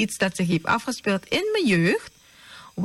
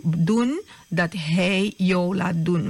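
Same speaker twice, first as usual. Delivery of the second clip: ordinary delivery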